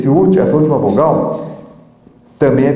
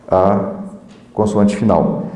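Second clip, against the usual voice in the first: normal talking voice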